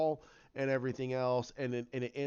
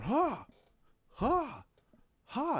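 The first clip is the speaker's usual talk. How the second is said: high-pitched, spaced "ha" cries; imitated training yells; 9 semitones higher, much slower than usual. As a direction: mimic students practising with high little shouts. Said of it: "and they just going".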